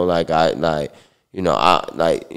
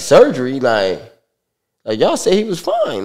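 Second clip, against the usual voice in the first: shocked disbelief; 8 semitones higher than usual. Retold or imitated. Mom shocked at surgery news.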